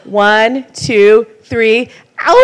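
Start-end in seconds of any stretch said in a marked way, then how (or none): 2.2-2.4 long loud howling wolf cry. Real, not acted. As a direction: throw your head back and howl like a wolf. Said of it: "give me a good wolf howl".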